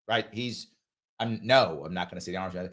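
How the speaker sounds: normal talking voice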